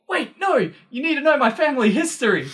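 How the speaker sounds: high, excited voice cutting in; mock eager explainer. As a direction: playfully impersonate the friend insisting.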